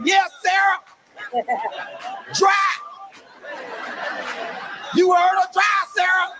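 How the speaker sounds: hyped shouting. Retold or imitated. Dad taunting his daughter's rival before the race, shouted high. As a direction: yell at the rival like a hyped fan. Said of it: "Got me all hyped".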